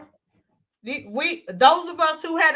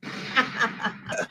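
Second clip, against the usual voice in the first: low-key, softer chuckle; real voiceless chuckling; amused laugh; 4 semitones lower, 7 dB quieter than usual. Real, not acted. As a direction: laugh in short breathy bursts. Laughs at the accent comment.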